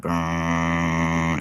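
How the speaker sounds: long droning "brrrr"; imitated vibration. Describing a distant sound in the Backrooms, imitated vibration. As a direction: draw out a buzzing rumble. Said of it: "aggressive vibration".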